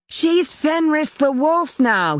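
high, drawn-out ringing call; slow, high shout carrying across the garden; boy calling up. A narrator's reading. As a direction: call it up from a distance, slowly. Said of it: "he called up to the mother".

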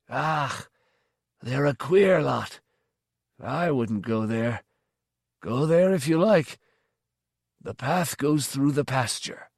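put-on slow, dismissive old villager; teasing old man. A narrator's reading. Old man sends the stranger south, slow and dismissive. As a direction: shrug them off as an old man, slowly.

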